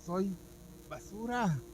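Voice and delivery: high, slow, pained crying-out; a high, aching lament; anguished plea. Retold or imitated. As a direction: plead brokenly with God. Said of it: "Y yo decía".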